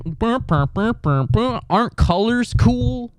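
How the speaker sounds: high, slow dopey monkey; mock monkey wonder; dumb monkey voice. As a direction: play a dazed monkey in awe. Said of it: "monkeys that just, like".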